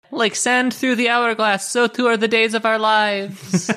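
high, dramatic soap voice; hammed-up melodramatic narration; soap opera drama. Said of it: "soap opera line".

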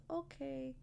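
tender self-talk to a struggling self; gentle inner parent. Pretend. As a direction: soothe yourself like a loving parent.

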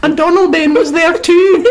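very high-pitched silly little prince; comic squeaky voice. Pretend. Comedian butting in as the forgotten royal son, comic squeak. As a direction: squeak it as a joke. Jokey squeaky Donalbain butt-in.

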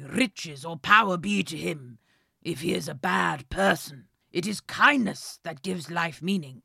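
put-on old, wise and a bit posh; old wise king. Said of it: "said the king".

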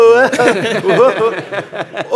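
loud laughing burst; hearty loud laugh. Real, not acted. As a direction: let a real laugh out. Laughs after the story.

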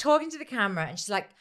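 ordinary talk, nothing marked

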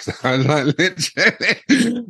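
high, helpless squeal of laughter; laughing through words. Real, not acted. Man cracking up at his own hobo flight, laughing.

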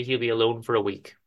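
usual speaking voice

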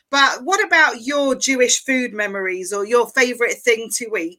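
usual speaking voice

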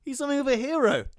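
news read. Acted. Redoing the news line.

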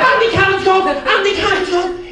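high, loud, laughing cheer; a factory worker yelling his good news, laughing; ecstatic shouting. Retold or imitated. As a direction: bellow it like you can't stop telling everyone. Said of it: "like he had Tourette's".

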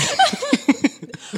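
high, shrieking laugh; high burst of laughter. Real, not acted. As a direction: let a squealing laugh burst out. Cracks up at own story.

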